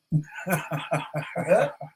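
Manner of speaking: tickled laughter after a punchline; real laugh. Real, not acted. Storyteller remembering a coworker's tease, real laughing. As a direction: crack up at your own story.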